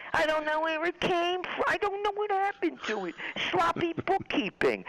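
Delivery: ridiculing a banker pleading ignorance; mock Corzine. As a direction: feign innocence in a squeaky mock voice. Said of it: "the John Corzine".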